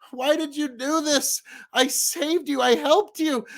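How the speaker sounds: high, pleading voice of the dying woman; dying woman's plea. Acted. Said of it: "she goes".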